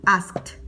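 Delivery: asked as "askt"; one-syllable asked with a released T; final T sound. Shown as a demonstration. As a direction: say asked with -ed turned into "t". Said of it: "lo vamos a pronunciar así".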